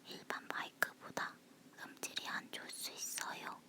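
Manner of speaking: breathy whisper close to the mic. Real, not acted. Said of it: "속닥거리는 목소리라서".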